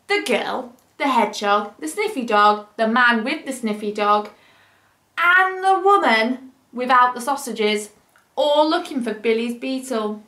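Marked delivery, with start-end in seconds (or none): none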